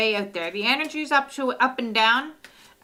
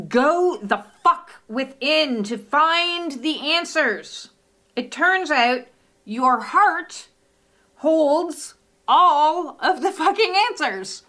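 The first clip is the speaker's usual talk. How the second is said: slow, emphatic proclamation building louder and higher; dramatic card reading; 5 semitones higher, 4 dB louder, slower than usual. Acted. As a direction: hammer each chunk out with big pauses. Dramatically reads blunt card message.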